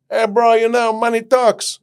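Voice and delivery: a high, loud bouncer voice; breezy bouncer. Retold or imitated. The bouncer telling him the shoes are fine, high and loud.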